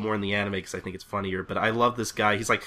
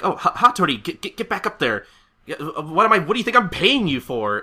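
high, stammering panic; freaking out; 6 semitones higher, 4 dB louder than usual. Acted. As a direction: stammer orders like a flustered boss. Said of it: "Tomu is freaking out".

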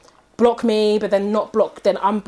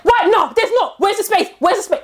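mimicking his own high whiny rant; 9 semitones higher, 4 dB louder than usual. Mocking the boyfriend scolding his mum about the freezer, high and whiny. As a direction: squeal it out like a nagging son. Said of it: "She's like".

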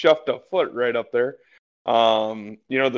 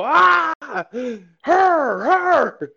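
high-pitched loud yelled correction; shrieked, far above your usual pitch; producer's scream; 8 dB louder, much slower than usual. Retold or imitated. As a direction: scream it like a frantic producer in an earpiece. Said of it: "hear the producer scream in her ear".

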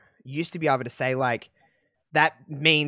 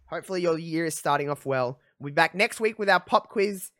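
normal talking voice

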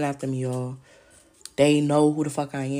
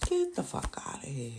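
whispered get-out, much softer and voiceless; hissed dismissal; 9 dB quieter than usual. Real, not acted. Whispering a get-out to the family who cheated her. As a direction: spit it out under your breath. Repeated dismissal, under breath.

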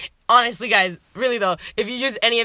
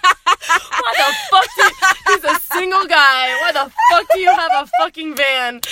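incredulous rant; 8 semitones higher, 6 dB louder than usual. Retold or imitated. Retelling how she ranted at her date about his van, in loud high mock outrage. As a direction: throw up your hands at a ridiculous van.

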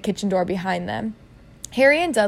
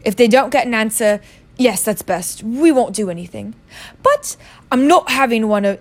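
louder, insistent uncle voice; Uncle Vernon; 5 dB louder than usual. Acted. Uncle tells his wife they will do nothing, firmly. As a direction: lay down the decision forcefully.

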